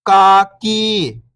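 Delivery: whole word, level then falling at the end; syllables blended. Shown as a demonstration. Teacher showing beginners the joined word, syllables blended. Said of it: "इनको आपस में लाके पढ़ना है".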